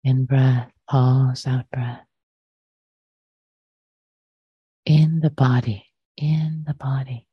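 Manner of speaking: measured meditation guidance with long pauses; short phrases, unhurried, letting silence settle between them; guided meditation. Acted. Teacher guiding listeners to arrive in body and breath, guided meditation.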